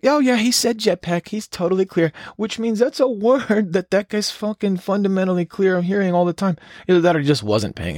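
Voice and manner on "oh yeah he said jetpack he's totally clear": high, squeaky offhand voice; mock bored controller. Controller shrugging off the jetpack report, high and squeaky. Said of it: "He was like".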